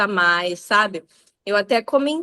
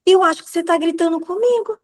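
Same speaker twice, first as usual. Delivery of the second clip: higher-pitched sing-song reproach, retold; mock warning; 7 semitones higher than usual. Mom retelling warning her shouting daughter, mock reproach. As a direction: play a mother pointedly noticing the yelling.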